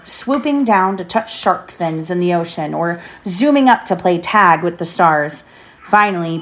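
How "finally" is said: usual speaking voice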